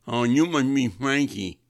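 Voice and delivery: imitated father with a speech impediment; harelip father. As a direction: boom out a cartoonish cleft-lip greeting. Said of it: "I ended up talking like that".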